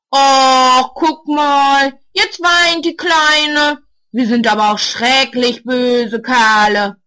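loud, slow taunting sneer; mocking. A narrator's reading. Boy mocks crying Marsha, loud taunting. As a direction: jeer at the crying girl. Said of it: "macht sich über sie lustig".

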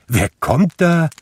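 high, surprised questioning call; astonished call. A narrator's reading. Fred calling to the unexpected visitor, surprised high call. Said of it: "rief Fred".

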